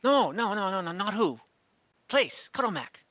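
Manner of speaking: a lightly voiced little kobold insisting, in halting words; kobold guide.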